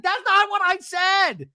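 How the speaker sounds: high, slow, loud mock yell; mock shouted denial. Acting out Emily's shouted denial.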